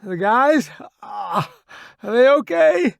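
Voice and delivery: high, loud, strained question; urgent, hurting, fearing for friends; pained worry. A narrator's reading. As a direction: ask anxiously through the pain. Wounded man asks after his friends.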